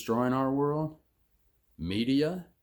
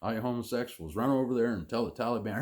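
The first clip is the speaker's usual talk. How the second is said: normal talking voice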